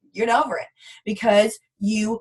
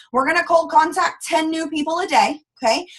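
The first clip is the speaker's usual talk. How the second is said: usual speaking voice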